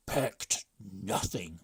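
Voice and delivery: loud, slow grumbling chief; the brigand chief, fed up; slow loud complaint from the chief; gruff chief. A narrator's reading. Chief cutting in.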